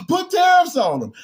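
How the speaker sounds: high, loud shout of defiance; fired-up shout at the camera; shouted demand. Real, not acted. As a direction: yell it out like a rallying cry. Heated rant about China tariffs.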